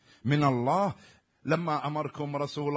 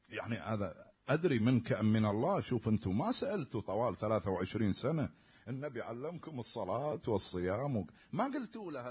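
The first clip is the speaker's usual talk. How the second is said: ordinary talk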